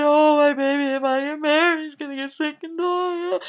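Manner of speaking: loud, high, tearful wail; mock crying. Girl explaining to parents, mock wailing. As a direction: blubber it out, hammed up. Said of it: "And she cried".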